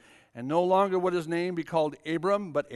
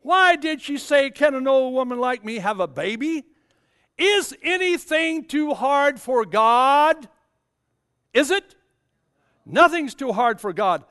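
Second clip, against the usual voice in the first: booming preaching; 7 semitones higher, 6 dB louder than usual. Acted. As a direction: thunder the question to the church. Voicing God with full force.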